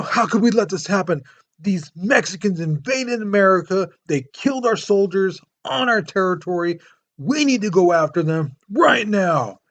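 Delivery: rally everyone to war, mock outrage; hammed-up outrage. Polk stirring up the country, hammed-up outraged cry. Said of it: "he's just screaming and yelling".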